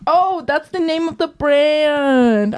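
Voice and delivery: slow, drawn-out oh of realization; high, slow dawning oh; amused realization. Real, not acted. Realizing the brand name, amused.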